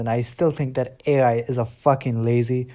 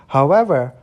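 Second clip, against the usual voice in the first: emphatic however; 7 dB louder than usual. Shown as a demonstration. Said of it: "this is very important".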